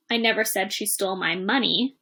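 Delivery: final word "money" carries the emphasis; stress on MONEY. Shown as a demonstration. Teacher shows she stole something else, stress on "money". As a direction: save the main stress for "money" at the end. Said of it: "as different words are stressed".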